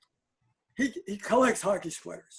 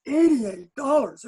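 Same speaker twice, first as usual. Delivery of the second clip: proud slow price; 6 semitones higher, 5 dB louder, slower than usual. Retold or imitated. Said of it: "He said".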